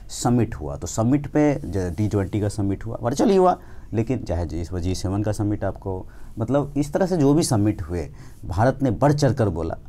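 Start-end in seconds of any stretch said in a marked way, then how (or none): none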